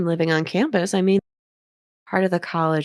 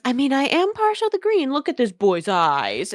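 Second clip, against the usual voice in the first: higher, playful partial mom; a smiling, higher mom voice; 7 semitones higher than usual. Acted. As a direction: tease with a smile. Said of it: "she smiles and goes".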